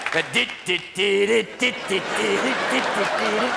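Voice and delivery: sung dit dit organ riff; vocal organ tune; organ music imitation. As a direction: mimic the ballpark organ.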